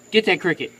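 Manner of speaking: ordinary delivery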